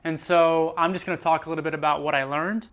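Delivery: ordinary talk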